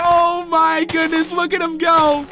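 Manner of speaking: very high, loud triumphant shouting; overjoyed yelling to the audience; ecstatic cheering. Real, not acted. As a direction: cheer like announcing a miracle. Villager finally farms.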